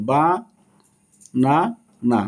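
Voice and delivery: "বা, না, না" one at a time with long pauses; separate syllables. Shown as a demonstration. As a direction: count out "বা, না, না" as three isolated beats. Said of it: "তিনটি syllable".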